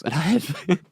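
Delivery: laughing through the words, pitch jumping high; sheepish laugh breaking into the words. Real, not acted. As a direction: giggle while admitting the embarrassing bit. Embarrassed admission of buying Midol.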